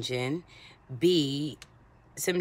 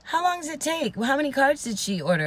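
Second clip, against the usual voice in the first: imitating the customer; 10 semitones higher than usual. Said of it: "And then she goes".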